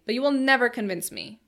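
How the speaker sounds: stubborn refusal. A narrator's reading. Philby refuses to be convinced.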